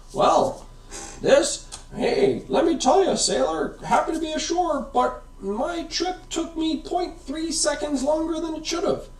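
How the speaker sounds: high put-on old sailor voice; salty sailor. Photon boat hails the listener as a sailor. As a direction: tell it like a weathered sailor spinning a yarn.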